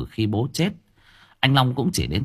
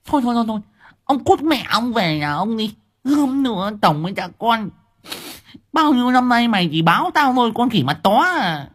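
much higher and louder, furious wife; hissing fury; 13 semitones higher, 4 dB louder than usual. A narrator's reading. Wife chases husband home, high furious screech. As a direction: screech him out of the room. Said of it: "chị Liên rít lên".

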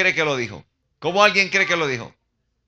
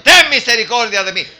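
loud, raised-pitch plea; top-of-lungs cry; 5 semitones higher, 9 dB louder than usual. Acted. Blind man begs Jesus for mercy, shouting. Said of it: "Gritando a voz en cuello".

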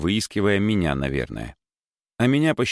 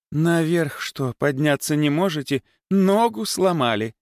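mocking suspicion, higher; suspicious; 8 semitones higher than usual. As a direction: sceptical questioning. Suspicious mother questions.

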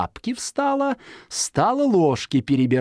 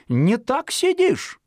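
high, slow impatient fox voice; fox scolding; 10 semitones higher, slower than usual. A narrator's reading. Fox to Zhikharka on the shovel, high impatient scolding. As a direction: correct him with sly impatience. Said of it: "говорит лиса".

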